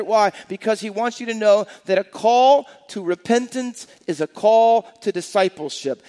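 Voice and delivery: punched key words, preacher building his case; forceful preaching. Real, not acted. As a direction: drive each point home to the congregation. Preaches closing point at full force.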